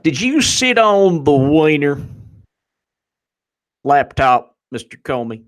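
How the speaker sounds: slow, loud Southern drawl rising high; Southern congressman. Acted. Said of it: "one of the Southern congressmen's like".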